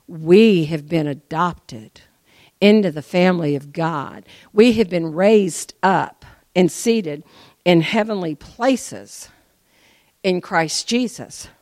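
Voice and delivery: slow, loud, emphatic preaching. Real, not acted. Preacher declaring to congregation, slow loud preaching. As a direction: lay each word down slowly and loudly.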